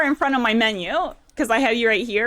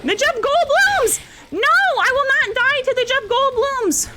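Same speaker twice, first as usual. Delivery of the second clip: panicked yelling. Real, not acted. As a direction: yell at the bosses in panic. Panicked boss fight outburst.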